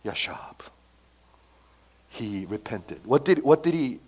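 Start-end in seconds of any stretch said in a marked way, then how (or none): 0.0-3.0 breathy whisper of the name; whispered aside. Real, not acted. Preacher to congregation, whispering the name. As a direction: lean in and whisper it.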